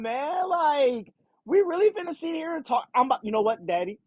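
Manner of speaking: put-on high, slowed whiny kid begging daddy on the phone; whiny teen daughter. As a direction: play the spoiled daughter nagging her father. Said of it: "that's how she's talking".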